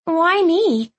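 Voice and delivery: high shrill protest. A narrator's reading. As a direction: squeak out a protest. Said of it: "Clove protested".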